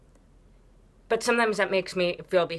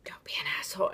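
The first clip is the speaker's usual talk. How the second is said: unvoiced whispered scolding; a playful whispered telling-off; 2 semitones higher, 4 dB quieter, faster than usual. Real, not acted. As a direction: hiss it under the breath. Whispered aside right after boo.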